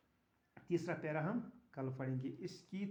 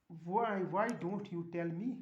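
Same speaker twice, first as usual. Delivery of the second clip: 1 pleading mother; 3 semitones higher, 6 dB louder, much slower than usual. Acted.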